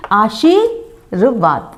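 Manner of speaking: आशीर्वाद drawn out very slowly; teacher spells आशीर्वाद out slowly; slow demo. Shown as a demonstration. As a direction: model आशीर्वाद slowly for the class. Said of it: "यानी शा के बाद आ रहा है".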